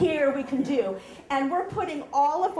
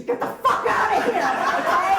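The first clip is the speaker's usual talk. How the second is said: loud, higher, laughing urgency; panicked yell; 8 semitones higher, 10 dB louder than usual. Retold or imitated. Friend yelling at her to flee the haunted house, loud panicked shout. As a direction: get out now, half-laughing shout.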